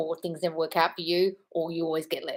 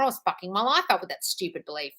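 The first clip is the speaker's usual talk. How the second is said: ordinary talk, nothing marked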